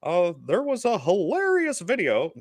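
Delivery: high, slow northern Canadian drawl; grandfather's Canadian accent. Retold or imitated. Grandson shows how grandfather talked, high slow Canadian accent. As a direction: put on the grandfather's Canadian talk, not exaggerating. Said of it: "he talked like this".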